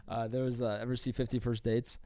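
ordinary talk

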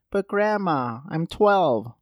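high-pitched mock kid complaint; put-on whiny twelve-year-old; protesting grandchild.

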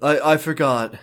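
normal talking voice

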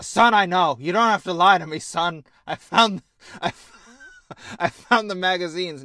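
loud, insistent father cutting in, breaking into laughs; mock-earnest dad nearly cracking up; pushy dad.

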